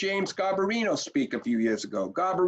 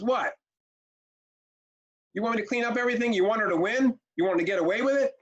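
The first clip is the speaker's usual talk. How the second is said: higher-pitched mock indignation; mildly voiced outraged mother, higher; retold angry outburst; 5 semitones higher, faster than usual. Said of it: "Lady gets mad".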